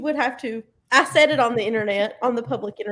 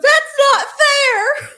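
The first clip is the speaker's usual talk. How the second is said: put-on slow, much higher, louder childish protest; whiny cartoon shout; 13 semitones higher, 8 dB louder, much slower than usual. Retelling Blue complaining in the video, hammed-up shout. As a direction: wail it like a cartoon dog losing a fight.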